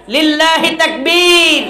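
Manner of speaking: high, slow, drawn-out slogan shout; rallying call. Real, not acted. Preacher calling the congregation to takbir, rallying shout. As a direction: call out to rouse the crowd.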